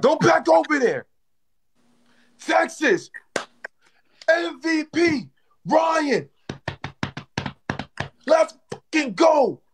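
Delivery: high, triumphant hype calls; high-pitched, drawn-out winner's taunt; victory hollering. Real, not acted. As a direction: call each one out high and proud. Taunts rivals, hails MVP.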